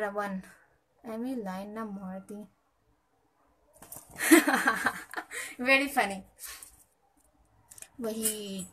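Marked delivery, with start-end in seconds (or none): 3.8-5.5 long, loud, high laugh; rolling burst of laughter. Real, not acted. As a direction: crack up laughing. Laughing at a chat comment.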